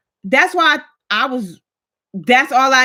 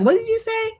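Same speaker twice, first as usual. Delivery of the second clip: high-pitched, incredulous question; mock disbelief; 13 semitones higher than usual. Offended friend questions her remark about banks, mock disbelief. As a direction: imitate a taken-aback friend.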